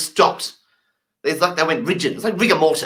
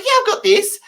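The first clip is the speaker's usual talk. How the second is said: high cocky claim; overconfident bluff. Retold or imitated. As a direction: squeak out false confidence. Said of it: "I'm just going".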